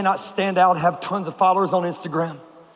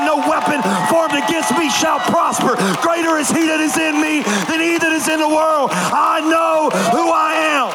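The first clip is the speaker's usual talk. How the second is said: defiant declaration; 9 semitones higher than usual. Shown as a demonstration. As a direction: shout it like a battle cry. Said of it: "pick up whatever's around you and say".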